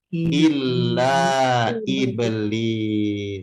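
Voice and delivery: very slow, drawn-out reveal; dramatic reveal. Acted. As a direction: stretch every syllable for suspense.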